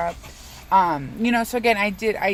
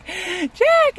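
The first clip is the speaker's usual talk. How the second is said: frantic cartoon mayor, much higher and louder; Mayor of Halloween Town; 13 semitones higher, 9 dB louder than usual. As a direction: squeal it out like a panicking small-town mayor. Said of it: "He's like".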